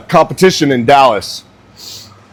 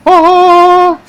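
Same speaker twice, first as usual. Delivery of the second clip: short high yelp, louder; sudden high yelp; 14 semitones higher, 5 dB louder than usual. Real, not acted. Telling a friend the blunder, sharp high yelp. Outburst mid-story.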